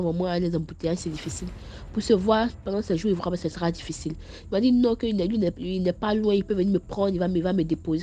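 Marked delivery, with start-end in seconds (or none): none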